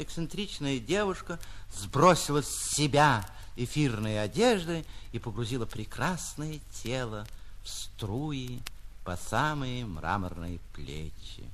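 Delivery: ordinary delivery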